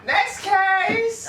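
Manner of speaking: very high, far-off mock announcer call; announcer shout. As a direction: bark it like a game-show host. Calling the next topic loudly.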